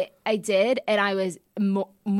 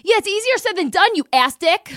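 high, louder sarcastic retort; mock snap; 8 semitones higher, 6 dB louder than usual. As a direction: bite back at him. Snapping back at boyfriend.

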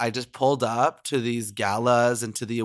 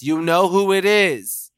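slow, louder playful taunt; teasing sing-song; 6 semitones higher, 5 dB louder, slower than usual. Acted. Host teasing the fan on the phone, slow sing-song. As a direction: make her guess, drawn out.

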